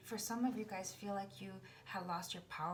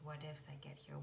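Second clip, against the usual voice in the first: usual speaking voice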